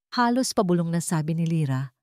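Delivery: ordinary delivery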